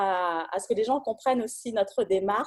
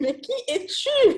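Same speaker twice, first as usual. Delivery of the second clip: much higher, slow mock-intrigued question; puzzled viewers; 14 semitones higher, slower than usual. Host playfully voicing viewers asking the guest who he is, drawn-out mock wonder. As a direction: stretch it out like a curious onlooker. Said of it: "sont sûrement en train de se dire".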